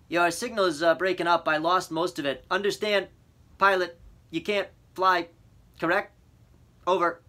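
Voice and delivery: clipped radio check; radio operator. A narrator's reading.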